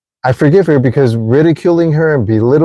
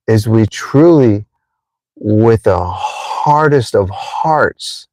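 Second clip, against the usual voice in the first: ordinary talk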